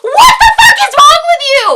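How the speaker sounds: high, very loud outburst; outraged shout. Real, not acted. Woman reacting to a pushy guy's line, outraged shout. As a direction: explode in disbelief at full volume.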